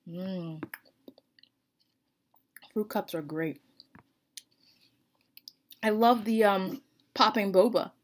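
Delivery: chewing while talking; eating from a spoon; mouth full. Real, not acted. Seen 2: Spoon to mouth just before; lips working on food throughout.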